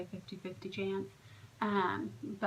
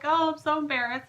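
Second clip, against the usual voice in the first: imitating an embarrassed outburst, higher and louder; mock flustered apology, pitched up; 7 semitones higher, 7 dB louder than usual. Imitating the tearful intended mom, embarrassed. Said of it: "and she was embarrassed".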